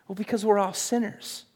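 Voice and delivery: ordinary talk, nothing marked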